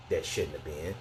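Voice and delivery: normal talking voice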